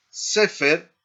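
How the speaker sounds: "sefer" stressed on its opening syllable; first-syllable stress. Shown as a demonstration. Teacher showing where the emphasis falls, first-syllable stress on sefer. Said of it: "el énfasis en la primera sílaba".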